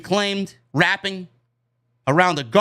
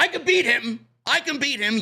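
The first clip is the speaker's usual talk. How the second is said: higher-pitched imitation of yelling to a crowd; mild mock yell, pitched up without much volume; 5 semitones higher than usual. Host imitating wrestler rallying the crowd, mild mock yell. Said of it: "yelling at the crowd".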